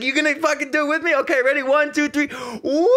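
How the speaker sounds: high, fast hyped count-in; rallying the crowd. Retold or imitated. One guy starting the wave, excited high count-in. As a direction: imitate a fan rallying strangers into a whoop.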